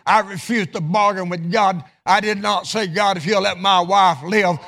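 full-throated pulpit exhortation; impassioned preaching. Real, not acted. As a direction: preach it with rising fervor.

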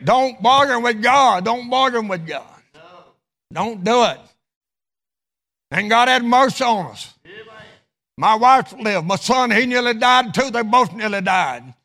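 full-throated pulpit exhortation; impassioned preaching. Real, not acted. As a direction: preach it with rising fervor.

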